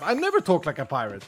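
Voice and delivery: ordinary delivery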